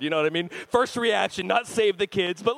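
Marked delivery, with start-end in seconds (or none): none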